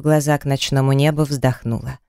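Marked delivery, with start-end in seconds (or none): none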